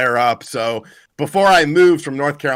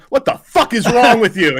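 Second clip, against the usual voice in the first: higher, louder mock outrage; mock indignant; 7 semitones higher, 4 dB louder than usual. To his doctor at the pharmacy, mock outrage. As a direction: confront her with hammed-up indignation. Confronting doctor in retold story.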